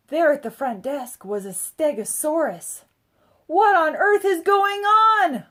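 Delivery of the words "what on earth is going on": slow, loud, high alarmed outcry; mother crying out in disbelief; drawn-out shocked exclamation; cried. A narrator's reading. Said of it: "my mom cried".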